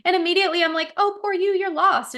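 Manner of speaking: high cooing coo to a lost kitty; high sing-song pity for a stray cat. Retold or imitated.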